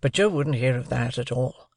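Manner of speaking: usual speaking voice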